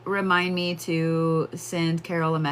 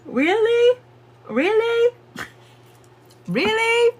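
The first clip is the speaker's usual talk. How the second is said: very high squeaky incredulous really; mock disbelief; 4 dB louder, slower than usual. Real, not acted. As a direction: exaggerate the disbelief playfully.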